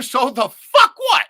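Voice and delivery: loud, slow defiant yell; defiant shout. Real, not acted. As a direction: shout it out slowly. Defiant rant.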